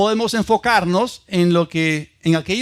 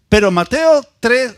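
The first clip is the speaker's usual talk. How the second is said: normal talking voice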